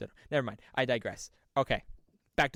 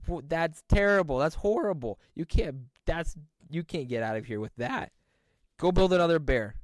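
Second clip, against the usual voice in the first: mock outraged guard, higher and louder; scolding guard; 5 semitones higher, 6 dB louder than usual. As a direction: tell him off like a fussy bouncer. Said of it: "the security teddy bear goes".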